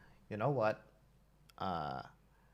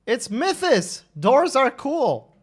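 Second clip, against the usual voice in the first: excited; 8 semitones higher, 6 dB louder, slower than usual. Real, not acted. Excited winner announcement.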